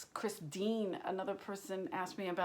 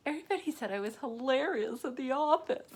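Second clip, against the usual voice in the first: higher, louder naive brag; hammed-up higher and louder boast; mock newcomer; 6 semitones higher, 5 dB louder than usual. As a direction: play the proud Midwest transplant. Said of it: "they're like".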